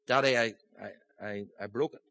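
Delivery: halting, stammering and trailing off quietly; scared little boy. Retold or imitated. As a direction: confess the broken toy, voice sinking away. Small boy confesses to stern father.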